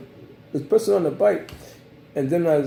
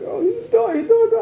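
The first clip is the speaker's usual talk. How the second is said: much higher, squeaky panicked imitation; mock panic; 4 dB louder than usual. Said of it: "and I was like".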